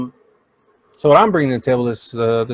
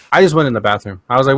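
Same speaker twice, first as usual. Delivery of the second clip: usual speaking voice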